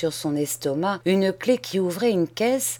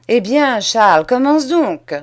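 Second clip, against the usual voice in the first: louder, raised command; loud order; 4 semitones higher, 8 dB louder than usual. Acted. Cousin orders Charles to start reading, loud and raised. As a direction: bark the order at him.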